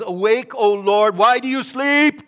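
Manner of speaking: high, loud demanding plea; crying out to God. Acted. David calling on the Lord in the Psalms, urgent cry. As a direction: call up urgently to wake Him. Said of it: "Because David talks like this".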